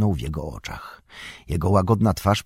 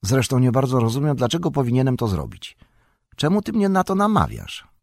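fast, rising suspicious question; a hobbit gone suspicious, quick and sharp; 6 semitones higher, faster than usual. A narrator's reading. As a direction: turn on the wizard, sharp and wary. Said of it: "a głos zmienił mu się jakoś dziwnie".

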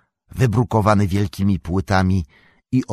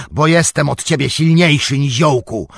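high, loud burst of anger; nagły krzyk; 8 semitones higher, 7 dB louder than usual. A narrator's reading. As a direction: suddenly shout down the halfling. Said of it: "krzyknął i znienacka". (nagły, gniewny krzyk)

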